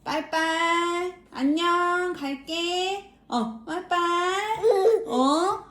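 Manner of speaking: high, slow sing-song goodbye. Real, not acted. Saying bye-bye to baby.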